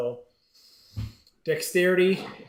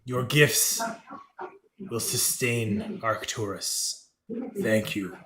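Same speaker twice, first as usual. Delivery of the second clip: slow, lowered creature voice; solemn creature god; 3 semitones lower, much slower than usual. Acted. God thanks the party for gifts, slow and solemn. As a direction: intone it slowly, with godly gravity. Game master closes as the god.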